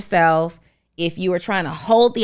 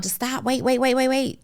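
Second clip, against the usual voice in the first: urgent interruption; 5 semitones higher than usual. Acted. Acted interruption urging someone to halt.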